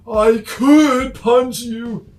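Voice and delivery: high, loud, slow cartoon threat; menacing character voice. Snowball threatening, put-on voice.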